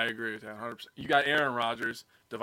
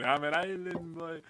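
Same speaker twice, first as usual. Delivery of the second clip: stupid voice; 5 semitones higher than usual. Retold or imitated. Co-host teasing with the island boy line, stupid voice. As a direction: lightly put on a silly voice. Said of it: "say that in their stupid voice".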